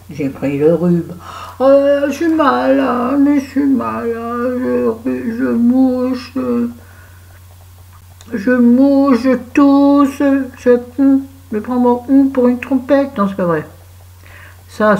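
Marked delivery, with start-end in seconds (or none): 1.6-6.3 mock sick whine. Complaining about having a cold. 8.3-13.2 hammed-up sick voice. Hamming up her cold.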